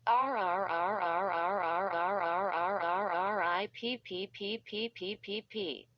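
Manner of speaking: long rising scream then sputter; drawn-out scream. Acted. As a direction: scream as you're torn away. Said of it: "Was that M E screaming?".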